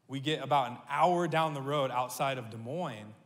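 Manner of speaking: ordinary talk, nothing marked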